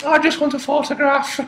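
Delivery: high put-on voice of the intruder; guilt-tripping plea. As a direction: plead in a high, wheedling character voice. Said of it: "made me feel bad saying".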